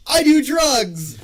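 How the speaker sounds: loud slow mock shout. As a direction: yell it out slowly.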